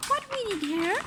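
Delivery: high, bright lady's voice; Missus Armitage voice. A narrator's reading. Parent reading aloud, voicing Missus Armitage, high and prim. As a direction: lift the voice up for the lady. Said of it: "said Missus Armitage".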